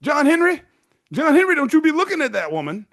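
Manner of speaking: high, loud scolding wife; scolding mama. Retold or imitated. Mama warning her husband to stop looking, high loud scolding. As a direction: call him out sharp and high. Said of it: "mama was like".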